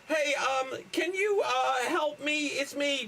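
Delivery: put-on high, hesitant boy voice; pleading kid. Grown-up boy begs his friend to recognise him, pleading.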